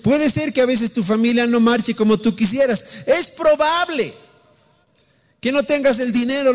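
high, impassioned preacher's cry held through every phrase; fervent preaching. Acted. Preacher urging the congregation through hardship, impassioned and high. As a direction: preach it up high with rising fervor. Preacher rises to full fervor.

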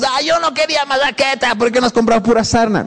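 high, hammed-up whine of protest; whiny complaint. Shopper grumbling over the bag of bread, whiny complaint.

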